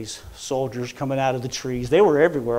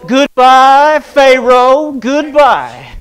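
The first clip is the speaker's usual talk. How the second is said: high, loud, joyful goodbye cry; sing-shout it at the shore; jubilant farewell; 10 semitones higher, 15 dB louder, much slower than usual. Retold or imitated. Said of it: "somebody started going like this".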